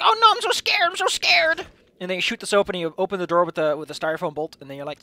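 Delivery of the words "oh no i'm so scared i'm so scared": squeaky, much higher, louder hammed-up fear; mock scared. Player pretending to hide in a room, hammed-up mock fright. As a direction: ham up being terrified behind the locked door. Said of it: "be like".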